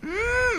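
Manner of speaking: squeaky "mm" to clear the throat; tiny falsetto throat-clear; imitating a squeaky attempt to clear the throat; high-pitched throat clearing. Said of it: "he tries clearing his throat".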